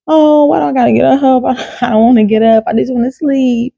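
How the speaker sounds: high-pitched, reluctant whine with a laugh in it; hammed-up sleepy-head complaint; mock whining. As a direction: protest like you're being dragged out of bed. Imitates past self resisting getting up.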